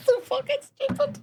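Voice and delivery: high, breathless laugh into the words; helpless laughing fit; crying with laughter. Real, not acted. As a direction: laugh till it hurts.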